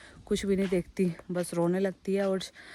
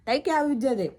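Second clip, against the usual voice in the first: raised voice calling across the street; 6 semitones higher, 5 dB louder than usual. Real, not acted. To Tai sowing seeds across the street, calling out. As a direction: call out to her from the house.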